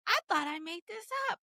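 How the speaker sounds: high-pitched mild disbelief; incredulous. Retold or imitated. Author shocked at real-life character.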